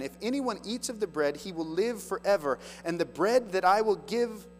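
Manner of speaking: scripture reading. Acted. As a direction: proclaim the verse to the congregation. Reads Jesus' words.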